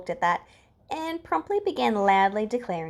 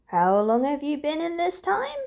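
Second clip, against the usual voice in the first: a lightly curious question; mild curiosity; 7 semitones higher than usual. A narrator's reading. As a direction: ask as if truly wanting to know. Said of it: "Hisashi asks, curious".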